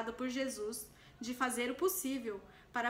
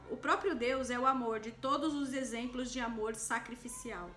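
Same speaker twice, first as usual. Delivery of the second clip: usual speaking voice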